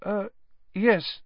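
timid, uneasy reply; nervous. A narrator's reading. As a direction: falter before agreeing. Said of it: "said Neville nervously".

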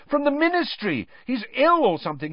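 high, fast frantic words; panicked pupil shouting at a teacher. Acted. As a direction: pour it out in a panic. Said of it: "Harry shouted".

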